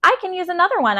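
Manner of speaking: high, earnest insistence; eager to smooth things over; earnest explaining. A narrator's reading. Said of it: "I explained".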